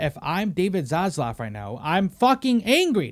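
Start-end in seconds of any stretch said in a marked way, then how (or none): 1.8-3.1 louder, higher, slower angry burst; pretend-boss rage, shouted; angry outburst. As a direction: blow up at the studio's mess. Venting as a furious studio boss.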